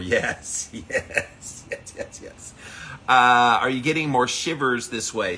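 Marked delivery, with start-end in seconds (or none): 0.1-2.5 breathy laughing. Real, not acted.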